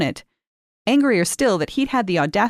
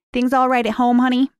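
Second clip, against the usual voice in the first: higher, blunt no-nonsense diner waitress; a fast-talking waitress checking on a girl, bluntly; middle-aged diner waitress asking straight out, higher pitched; 6 semitones higher, 3 dB louder than usual. A narrator's reading. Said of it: "she'd asked Kate bluntly".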